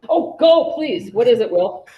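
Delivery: high, loud eager invitation; excited. Real, not acted. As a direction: jump in eagerly, pitch up. Student suddenly has answer.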